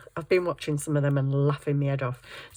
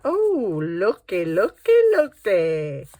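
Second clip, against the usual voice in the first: playful sing-song; 2 semitones higher, 6 dB louder, slower than usual. Real, not acted. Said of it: "Sue's in crazy mode today".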